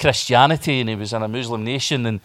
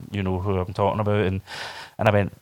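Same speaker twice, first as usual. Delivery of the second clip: usual speaking voice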